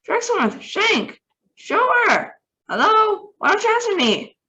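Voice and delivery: very high, loud calls into dead radio; frantic calling. Acted. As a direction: keep calling names, growing desperate.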